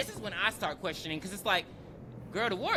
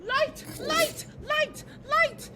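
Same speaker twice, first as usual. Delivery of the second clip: very high, frantic calls; high repeated mock cries; mock panic call; 3 dB louder, much slower than usual. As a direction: cry out for light like a scared mother. Mother calling for light.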